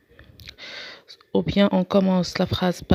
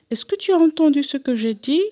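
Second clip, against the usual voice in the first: higher, insistent reproach in the text; lively read question; 7 semitones higher, 3 dB louder than usual. Acted. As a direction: ask it with a rising, pressing tone. Reading a character's reproach. (question insistante, plus aiguë)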